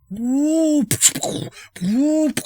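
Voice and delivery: high sliding whoop noise; a high swooping sound effect for shutters; mock shutter whoop. GM making the shutters whoop open, high sliding noise.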